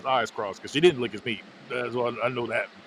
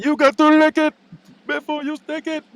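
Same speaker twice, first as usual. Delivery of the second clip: mocking taunt; 14 semitones higher, 5 dB louder than usual. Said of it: "that's when they say".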